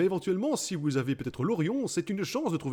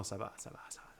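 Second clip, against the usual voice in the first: whispered, hushed reassurance; softly whispered it's fine, it's fine; 7 semitones lower, 14 dB quieter than usual. Real, not acted. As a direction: breathe it out under the breath.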